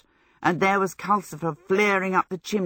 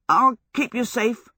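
high, nervous promise; shaky reassurance; 6 semitones higher, 4 dB louder than usual. A narrator's reading. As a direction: promise protection while sounding scared yourself. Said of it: "he said shakily".

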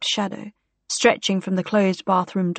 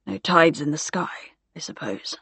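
musing murmur; 4 semitones lower, 4 dB quieter than usual. A narrator's reading. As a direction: let it drift away quietly. Gore trails off musing.

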